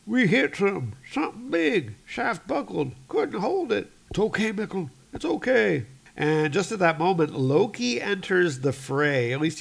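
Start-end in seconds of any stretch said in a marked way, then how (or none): 0.1-3.9 high, strained gasped-out phrases; short bursts, pitched way up; injured man's report. Acted. Injured man explaining the accident, high and strained. 4.1-5.9 higher-pitched urgent reassurance; comforting rescuer. Acted. Rescuer reassuring the injured man, higher and earnest.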